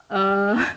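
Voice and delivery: "uh" held as a lax mid vowel; relaxed schwa. Shown as a demonstration. Linguist repeats the schwa example, relaxed "uh". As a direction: let "uh" fall out of a relaxed mouth. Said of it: "really relax your mouth".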